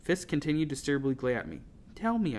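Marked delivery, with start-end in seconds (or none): 1.9-2.4 high-pitched plea; earnest, higher little voice; pleading. A narrator's reading. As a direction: plead to be told. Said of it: "I pleaded".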